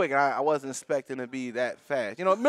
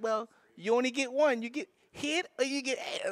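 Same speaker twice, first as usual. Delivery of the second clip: mimicking her; 9 semitones higher, 5 dB quieter than usual. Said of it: "She like".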